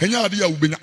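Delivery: loud, chanted Twi sermon with shouted peaks; fiery pulpit chant; preaching at full force. Acted. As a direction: preach it hard, half singing.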